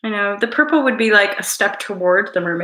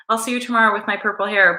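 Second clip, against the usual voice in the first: usual speaking voice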